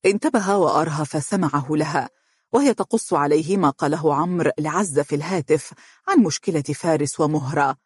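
ordinary delivery, nothing marked

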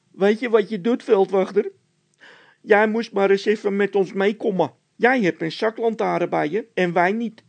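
high, loud panicky voice; frightened Bol. A narrator's reading. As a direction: panic about the noise. (Bol in paniek, hoog en luid)

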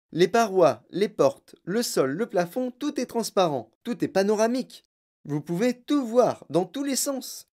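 showman inventor, enthusiastic. Acted. Inventor boasts that his glass lift is all transparent, excitedly. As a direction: show off each marvel, climbing in pitch.

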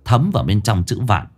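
plain reading voice

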